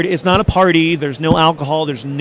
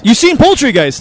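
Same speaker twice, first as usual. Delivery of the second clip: higher, louder in surprise; surprised; 7 semitones higher, 5 dB louder than usual. Real, not acted. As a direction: react with delighted surprise. Surprised host repeats guest.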